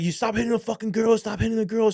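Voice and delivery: high-pitched, urgent protest; imitated crowd outcry. Everybody telling a man to stop hitting the girl, imitated outcry. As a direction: voice onlookers crying out in alarm. Voicing crowd yelling at attacker.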